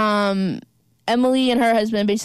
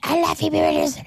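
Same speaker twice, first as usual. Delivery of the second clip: mock fan voice; 6 semitones higher than usual. Imagined Letterboxd reviewer.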